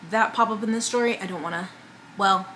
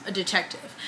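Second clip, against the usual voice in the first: softer, hushed repeat of "a detective"; hushed aside; 3 semitones lower, 8 dB quieter than usual. Shown as a demonstration. Vlogger repeating to her viewers, softer, a hushed "a detective". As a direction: drop to a hush on "a detective" as a wry aside.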